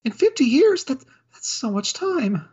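a timid maid caught out, sorry and panicking; flustered clumsy maid. Acted. Maid Felicia apologises to butler Jacob and then drops the snacks, in a high flustered voice.

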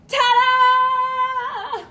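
high, loud, sung reveal; long, ringing high note; triumphant flourish. Acted. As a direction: sing it out like a big reveal. Triumphant flourish after the punchline.

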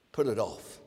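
low, flat command, PUT hit; low firm retort. Acted. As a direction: cut the excuse short. Repeated retort, much lower.

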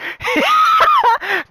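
very high, loud shrieking laugh. Real, not acted. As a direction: burst into a squeal of laughter.

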